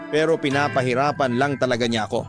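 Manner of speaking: normal talking voice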